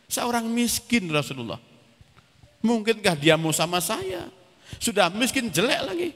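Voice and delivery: high-pitched, plaintive protest; imitating a poor suitor's doubtful plea; incredulous self-deprecation. Zahid doubts he deserves the bride.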